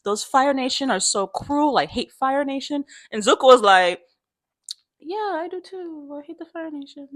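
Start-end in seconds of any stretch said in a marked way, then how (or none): 0.0-2.9 higher-pitched imitation of thankful parents; grateful family. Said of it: "the family's like". 5.0-7.1 tiny, high, hushed voice; sheepish fake agreement. Zuko hiding that he's Fire Nation.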